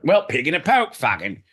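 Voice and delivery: high, loud fake Cockney mimicry; hammed-up Cockney imitation; mock Cockney. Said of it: "John's like".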